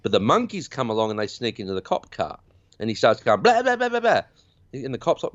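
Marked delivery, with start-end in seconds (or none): 3.4-4.2 high-pitched rapid "blah" noise; imitated frantic babble. Retelling the sneak into the cop car, high imitated babble. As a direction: rattle out a shrill-pitched mock babble.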